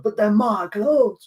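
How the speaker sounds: high, slow mock-Scottish protest; hammed-up Scottish accent. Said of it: "That's my Scottish accent".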